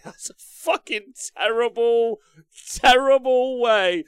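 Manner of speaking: stretched-out exasperated rant; outraged emphasis. Real, not acted. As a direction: lean on terrible in real disgust. Outraged at bad advice.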